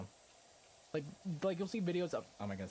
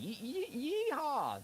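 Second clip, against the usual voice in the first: hammed-up Texas yeehaw; mock cowboy shout, pitched way up; mock Texan yeehaw; 14 semitones higher, slower than usual. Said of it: "they're like".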